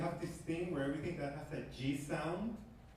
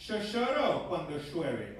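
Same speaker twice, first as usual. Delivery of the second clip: Argentine "Yo lloro cuando llueve" with sh for y and ll, a little slower; Argentine accent. Shown as a demonstration. The Argentine version for the audience, Argentine sh sounds. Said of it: "But in Argentinian".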